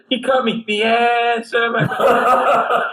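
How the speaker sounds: high, drawn-out mock wail; drunken screaming. Drunk slashed at the pool table, screaming. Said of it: "he's screaming, but he's drunk".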